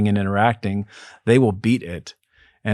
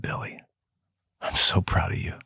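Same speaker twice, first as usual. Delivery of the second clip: soft whisper of pride; whispered tenderly; 10 dB quieter than usual. Retold or imitated. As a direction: whisper it gently, like consoling him. Tender words to crying client.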